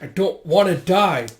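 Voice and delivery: slow, high and loud protest; desperate outcry. Acted. Dying man refusing to go, desperate and loud. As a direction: cry out against dying, stretching the words. Beaten man pleads against death.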